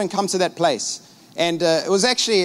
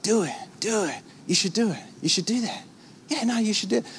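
hushed, coaxing devil voice, much softer; tempting devil; 2 semitones higher, 7 dB quieter than usual. Acted. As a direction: lure him in quietly like the devil. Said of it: "the devil's like".

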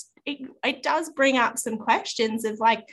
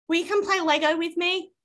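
put-on child asking; 7 semitones higher than usual. Child pestering sister to play.

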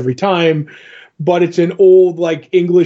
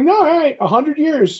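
much higher, a mock old commoner; lightly voiced tavern regular; high-pitched Hobbs voice; 10 semitones higher than usual. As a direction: pitch it way up as the rough old drinker. Hobbs quoted in retelling.

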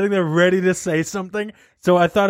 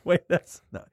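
ordinary delivery

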